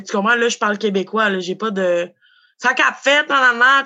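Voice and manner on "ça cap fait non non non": higher, louder street-slang persona; stage character voice. Retold or imitated. Rapper persona he played online, lightly put-on slang voice. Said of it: "un personnage que je jouais".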